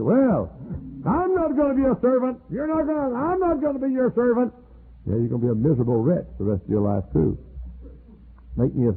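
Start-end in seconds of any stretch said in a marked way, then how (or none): 0.0-4.5 high, mocking voice of a stubborn person; proud refusal. Preacher mimicking someone who won't serve, high and mocking. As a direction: pitch it up high, sneering refusal. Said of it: "somebody said".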